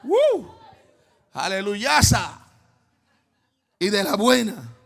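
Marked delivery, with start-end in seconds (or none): 0.0-0.5 sharp high yelp of praise; short high joyful whoop. Real, not acted. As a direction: yelp out in holy excitement.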